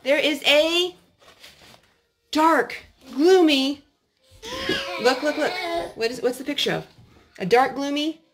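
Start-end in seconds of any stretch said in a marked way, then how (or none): none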